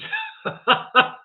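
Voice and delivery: high burst of laughter; breathy, helpless laugh. Real, not acted. Reacting to the chat's teasing, a high burst of laughter. Amused by chat messages.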